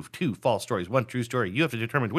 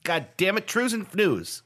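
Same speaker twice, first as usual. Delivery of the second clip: worked-up, higher-pitched hype; 7 semitones higher than usual. Pretend. Host hyping the classic game, worked up. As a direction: hype the game with mock exasperation. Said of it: "Why are you so worked up?".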